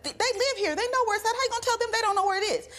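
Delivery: high-pitched indignant retort, rushing at the end; exasperated arguing. Retold or imitated. Retells arguing back over directions.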